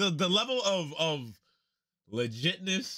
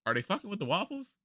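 ordinary talk, nothing marked

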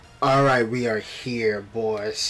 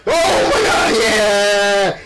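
excited shout; 4 semitones higher, 3 dB louder, much slower than usual. Real, not acted. Big move in race.

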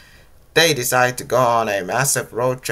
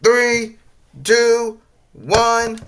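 high, loud, evenly spaced countdown; 10 semitones higher, 5 dB louder, much slower than usual. A narrator's reading. Flippy counts down to the TNT blast, calling loudly. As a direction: call out each number high and loud. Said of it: "Flippy count down".

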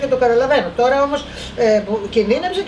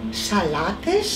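ordinary talk, nothing marked

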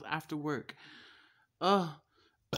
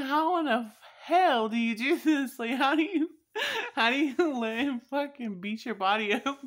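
louder and higher, strained at the end near tears; incredulous retold question; 8 semitones higher, 7 dB louder than usual. Asking her cousin how she takes the beating, higher and louder. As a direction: pitch it up and push it, half laughing in disbelief.

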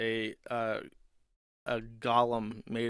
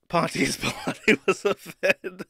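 laughing; 6 semitones higher, 8 dB louder, slower than usual. Real, not acted. Laughing punchline.

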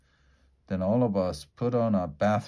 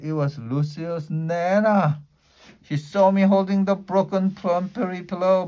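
ordinary delivery

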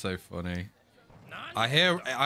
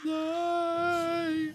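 much higher cry of disbelief; shocked cry; 13 semitones higher, 3 dB quieter than usual. Real, not acted. Fan shocked by the scene, high shocked cry.

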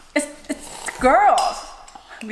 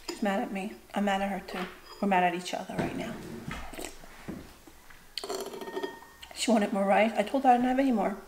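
quiet; 8 dB quieter than usual. Real, not acted. Talking quietly near the angry child.

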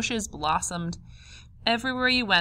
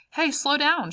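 high, sharp sisterly shout; 9 semitones higher, 4 dB louder than usual. A narrator's reading. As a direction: shout at a greedy sister, pitched up. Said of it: "shouted Doris".

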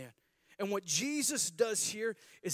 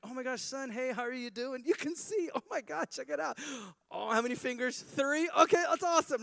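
much higher, excited gushing; giddy parents; 8 semitones higher, 6 dB louder than usual. Acted. Parents greeting their healed son, high excited gushing. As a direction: gush like thrilled parents.

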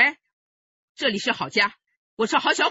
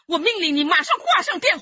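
louder, stern order; stern command; 6 semitones higher, 5 dB louder than usual. Acted. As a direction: lay down the law. Grandmother orders friend off phone. (婆婆严厉地命令她挂电话)

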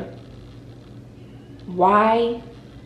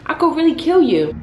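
higher-pitched exasperated threat; mock seething threat; 5 semitones higher, 4 dB louder than usual. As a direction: threaten a sleeper, half-playfully exasperated. Seething at sleeping partner.

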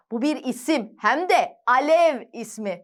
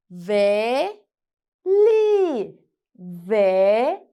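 sounding out words; 5 semitones lower, much slower than usual. Shown as a demonstration. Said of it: "Birleştiriyoruz".

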